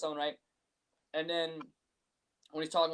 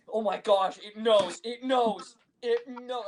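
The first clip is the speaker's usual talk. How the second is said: loud, raised-pitch mock panic; hammed-up startled realization; retold alarm; 5 semitones higher, 6 dB louder, much slower than usual. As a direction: exclaim it as if just caught out. Retold panic at being caught cheating.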